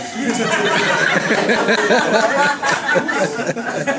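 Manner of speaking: loud, high burst of laughter; real laughter at the memory; long loud laugh. Real, not acted. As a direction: crack up at the punchline. Laughing at Billy's comeback.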